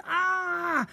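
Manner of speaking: high frightened shriek; terrified cry. Acted. Ned shuts his eyes and cries out, high shriek. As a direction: cry out in terror. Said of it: "he cried".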